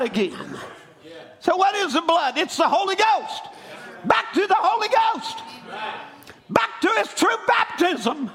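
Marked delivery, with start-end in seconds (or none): 1.4-8.3 preaching at full force. Acted. Preacher to congregation, calling them back to Christ, full force preaching. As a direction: rise up and preach it out to the church.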